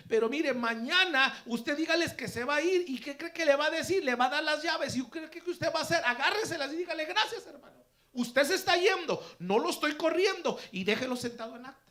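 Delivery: loud, high-pitched, driving sermon delivery; forceful preaching. Real, not acted. Preacher explaining the verse to his congregation, forceful preaching. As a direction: drive the point home to the congregation.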